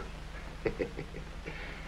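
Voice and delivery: a soft, sly villain's chuckle; breathy sinister snicker; gloating chuckle. Acted. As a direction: laugh quietly at the boss's fear. Flat Top gloats over blackmail.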